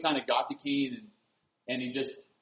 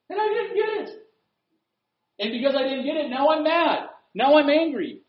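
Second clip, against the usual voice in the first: high-pitched, indignant outburst; mock tantrum; 11 semitones higher than usual. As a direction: act out a petulant fit, starting in a squeal. Acts self-centred person getting mad.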